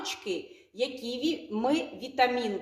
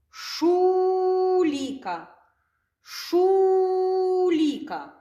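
Шуліка stretched very slowly, pitched higher; slow model word; 7 semitones higher, much slower than usual. Shown as a demonstration. Teacher modelling Шуліка for children, very slow and higher. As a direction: draw out every sound of Шуліка. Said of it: "teacher models the word twice".

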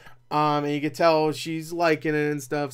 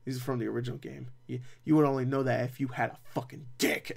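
softer, low-key smug aside to the camera; a sly knowing wink in the voice; 3 semitones lower, 5 dB quieter than usual. Retold or imitated. Heroine winking at game fans, mock smug and softer. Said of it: "look at the camera and wink and go".

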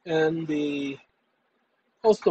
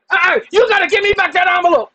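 higher, louder, hammed-up clerk shout; mock shouting clerk; 10 semitones higher, 5 dB louder than usual.

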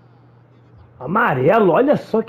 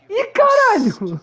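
surprised outburst; 11 semitones higher, slower than usual. Real, not acted. Shock at a play in game.

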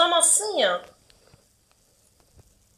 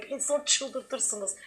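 weary and fed up; 4 semitones lower, 10 dB quieter, much slower than usual. Real, not acted. Fed up, trailing off.

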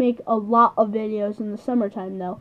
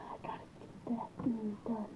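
whispering; 19 dB quieter than usual. Real, not acted. Muttered aside to self.

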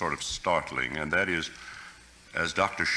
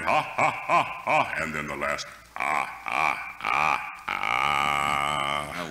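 descending mocking ha ha ha; Mephisto's sneering stage laugh; much slower than usual. Shown as a demonstration. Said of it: "A wonderful sneer in the voice".

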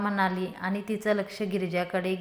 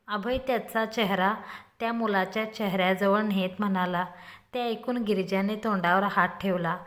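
ordinary delivery

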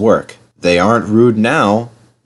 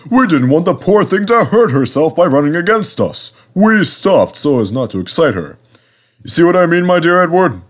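pompous, higher-pitched self-justifying; huffy; 7 semitones higher than usual. A narrator's reading. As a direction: explain yourself with wounded dignity. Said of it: "said Gordon huffily".

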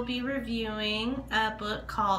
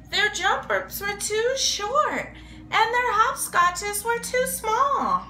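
higher, lively storybook narration; reading to children; 6 semitones higher than usual. Acted. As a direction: read it like a picture book to little kids.

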